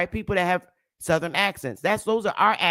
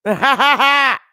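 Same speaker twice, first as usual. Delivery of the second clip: high, loud burst of laughter; 9 semitones higher, 11 dB louder than usual. Real, not acted. Host reacting to an old document, loud laugh.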